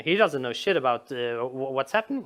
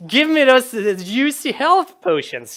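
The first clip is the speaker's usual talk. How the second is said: loud, higher acted demand; mock greedy player; 8 semitones higher, 8 dB louder, slower than usual. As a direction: demand the loot with playful greed. Player greedily exploiting the rabbit.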